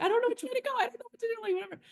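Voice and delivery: high-pitched imitation of panicking; mild mock panic. Friend imitates someone lost in the maze, mock panic. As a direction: squeal it like someone a little panicked. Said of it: "they're kind of panicking a little".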